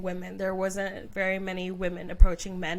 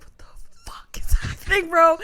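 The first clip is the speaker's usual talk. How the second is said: whispered, higher, stunned; 7 semitones higher, 6 dB louder than usual. Retold or imitated. Friend imagines her reaction on the date, a stunned whisper. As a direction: breathe it out in disbelief. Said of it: "being like".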